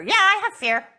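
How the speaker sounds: squeaky high puppet hello, loud; puppet Jasmine greeting. Acted. Puppet Jasmine greets the audience in a high squeaky voice. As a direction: pop up and chirp hi.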